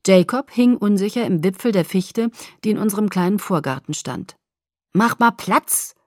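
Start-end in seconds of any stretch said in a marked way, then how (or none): 4.9-5.9 slow, raised order; commanding. A narrator's reading. Boy orders her aside before swinging in, commanding. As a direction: give a drawn-out command from above. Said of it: "befahl er".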